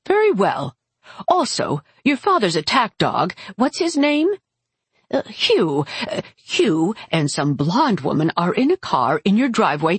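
high, swooping, haughty aunt voice; imperious aunt. Acted. Aunt reporting the visitors in the driveway, imperious. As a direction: announce it grandly, groping for the name.

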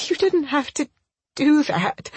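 high, tearful, halting voice; crying in earnest. A narrator's reading. Crying woman telling him it wasn't needed, tearful. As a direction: force the words out through tears. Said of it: "now I am crying in earnest".

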